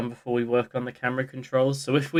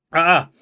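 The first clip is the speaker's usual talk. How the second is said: pained yelp; 4 semitones higher, 8 dB louder than usual. Real, not acted. After the coughing.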